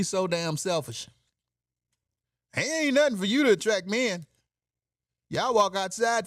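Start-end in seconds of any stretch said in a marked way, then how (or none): none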